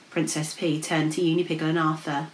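usual speaking voice

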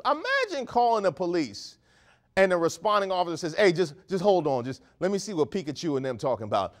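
ordinary talk

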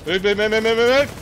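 loud, high, rapid urging; urgent shouting. Real, not acted. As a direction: yell at the squad to move. Urging teammates mid firefight.

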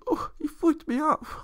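very high, slow mocking whine; mock him like a whimpering crybaby. Mocking Rodgers crying about being flicked off, high whine. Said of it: "still did a little crybaby".

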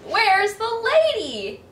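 a lost pup crying for her; anxious dog cry. Acted.

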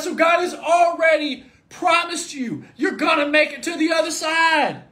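loud, high, slow shouted sermon; drawn-out proclamation to the congregation; preaching at full force. Acted. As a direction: hammer home the promise. Preaching climax.

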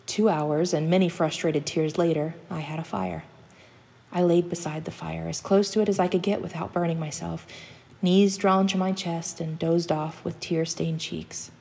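usual speaking voice

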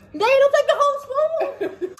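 high laughing protest. Real, not acted. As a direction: squeal it through laughter.

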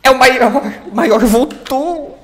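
excited shout. Real, not acted. Big moment in game.

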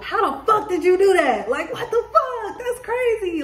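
very high, laughing disbelief; imitated amazement. Voicing what people will say of your success, mock astonishment. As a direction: squeal the question as a stunned onlooker. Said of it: "I'm hearing a lot of".

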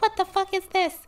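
high, loud mock-freakout; shocked outburst. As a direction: act out an outraged squawk, way up high. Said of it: "I'm gonna be like".

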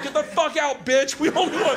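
mock mob demand; heckling crowd. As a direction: boo someone off stage. Retold crowd booing performer off stage.

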